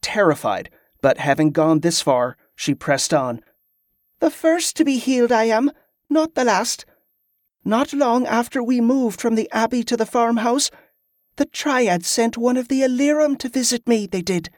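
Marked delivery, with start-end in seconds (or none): 4.2-14.5 put-on high-pitched girl pressing on; high girlish voice, earnest; confessing young Molly. A narrator's reading. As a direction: reveal a secret in a high child's voice.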